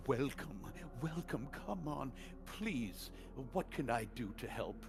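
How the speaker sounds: lightly voiced eager merchant; a hint of a scheming host, welcoming; mustache twirling villain. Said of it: "twirls his mustache".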